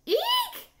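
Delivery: very high, loud "eek"; startled bird's piercing squeal; alarmed shriek. A narrator's reading. Mama bird spots babies hanging from nest, high shriek. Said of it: "she cried".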